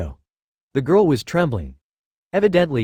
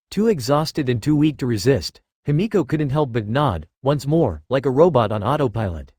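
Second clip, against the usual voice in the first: ordinary delivery, nothing marked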